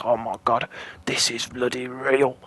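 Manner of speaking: low, breathy retold "oh my God"; mild hushed disbelief; hushed panic. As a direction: say it under your breath, awed and scared. Same retold moment of panic.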